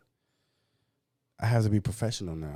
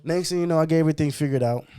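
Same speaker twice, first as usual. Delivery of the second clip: excited rush; 8 semitones higher, 6 dB louder, faster than usual. Real, not acted. Excited retelling.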